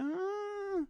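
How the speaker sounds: drawn-out high squeal of hesitation; long high "ahh". Retold or imitated. Retelling a doubtful reply about God, long high "ahh". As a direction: stall on a high, stretched "ahh".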